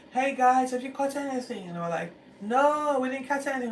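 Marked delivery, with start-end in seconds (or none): 0.1-1.7 high, loud hail across water; yelling from shore. Retold or imitated. Stranger on the shore calling to fishermen, loud hail. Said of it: "someone yelling from the shore". 2.4-3.7 high, loud reply across water; shouting back. Retold or imitated. Fishermen calling back from the boat, loud.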